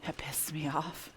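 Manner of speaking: muttered whisper. Real, not acted. Annoyed aside about quest.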